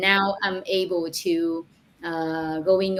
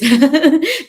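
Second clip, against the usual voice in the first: bright laugh; 9 semitones higher, 11 dB louder than usual. Real, not acted. Laughs about buying a bigger home.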